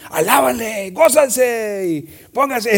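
stretched-out mock radio cheer; radio hype voice. Preacher mocking his own radio talk, slow hype. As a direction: ham up the easy mic hype, slowly. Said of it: "cuando estás así con el mic".

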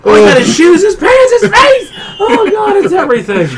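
high, loud mock lament; Diana's horrified wail. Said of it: "the best is Diana going".

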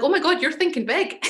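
lightly acted astonishment; amazed reaction. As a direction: mimic an impressed onlooker. Retells fellow panellist's amazed reaction.

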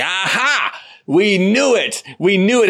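high, loud mocking gotcha cry; mock triumph. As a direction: crow like a caricature who's been proven right. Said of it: "some people on the left are gonna be like".

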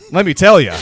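fighting back laughter; laughing. Real, not acted. Laughing fit at practice story.

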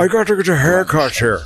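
a hint of the comic's goofy delivery; Harlan Williams impression. Quoting the comic to his co-host in a light put-on voice. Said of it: "is that Harlan Williams?".

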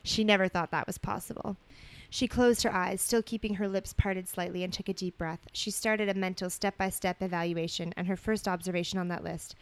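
measured storybook reading voice; story narration. A narrator's reading. Co-host reading romantic fan fiction aloud, story narration. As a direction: read it out evenly as narrative.

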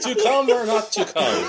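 hammy Shakespeare mock recital; Shakespearean parody. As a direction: laugh through a Hamlet mockup. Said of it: "reading Shakespeare".

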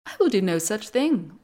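loud defiant refusal. Acted. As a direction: refuse the gods boldly. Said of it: "replied Arachne".

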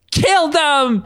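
high screamed command. Acted. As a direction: scream the order. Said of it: "Belladonna screamed".

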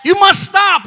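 high, forceful command; urgent warning. Acted. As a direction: cry out to halt them. Acted urgent warning to someone.

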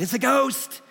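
high, frightened yelp of a disciple; frightened disciples crying out at a ghost on the lake; terrified cry. Retold or imitated. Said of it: "they said".

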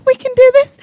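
high, building up to loud; growing excitement, rising into a cheer; getting excited. Shown as a demonstration. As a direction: roommates pumped up by the dance music, building excitement. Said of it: "then you're like".

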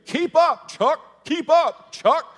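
high, loud barked "keep up"; instructor screaming. Retold or imitated. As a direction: yell like a spin coach driving a class. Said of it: "she's screaming".

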